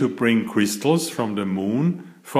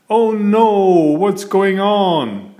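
alarmed cry; 8 semitones higher, 5 dB louder than usual. A narrator's reading. Friends crash onto the moon, alarmed high cry. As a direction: panic as the capsule hits.

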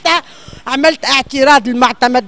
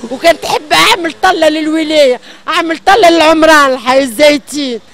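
angry crying; 4 semitones higher, 6 dB louder than usual. Real, not acted. Distressed woman complains loudly.

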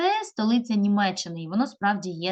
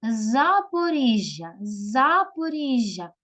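Запоріжжя called slowly, higher, with long і; stress on І; 3 semitones higher, 3 dB louder, much slower than usual. Shown as a demonstration. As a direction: stretch the і in Запоріжжя. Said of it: "наголос падає знову на І".

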